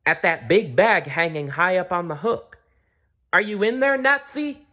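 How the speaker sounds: high mouse calling up high. A narrator's reading. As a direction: call up to the hook. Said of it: "she called".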